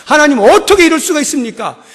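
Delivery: loud, indignant cry to God; protesting complaint. Acted. Prophet protesting to God, loud and indignant. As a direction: pour out the grievance. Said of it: "하나님 앞에 항거하는 거예요".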